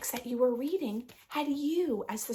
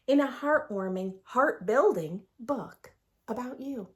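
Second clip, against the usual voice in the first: lively sing-song picture book read; reading to children; 3 semitones higher, 4 dB louder than usual. A narrator's reading. Teacher reads the rhyming book to her class. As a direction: read the rhymes aloud with bounce.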